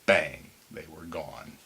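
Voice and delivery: ordinary delivery, nothing marked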